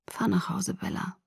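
breathy whisper. A narrator's reading. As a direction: whisper it, barely audible. Jacob ends it quietly. (geflüstert)

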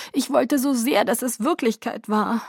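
high-pitched tearful lament; plaintive voice full of tears; crying. A narrator's reading. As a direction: weep out the longing. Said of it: "sagte ich weinend".

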